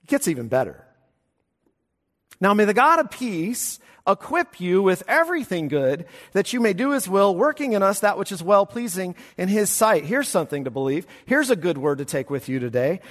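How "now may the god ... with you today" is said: higher and louder, ringing blessing; fervent benediction. Real, not acted. Pastor pronouncing the benediction over his congregation, fervent and ringing.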